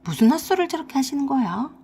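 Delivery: usual speaking voice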